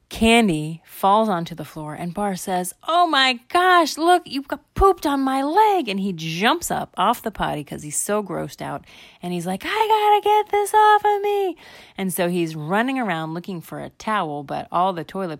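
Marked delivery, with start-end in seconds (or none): 2.8-5.9 put-on high, loud kid outrage; acted high-pitched freak-out; grossed-out yell. Bar grossed out, yelling. 9.6-11.5 put-on very high, loud kid shriek; acted frantic high yell; panicked disgust. Bar panics over poop.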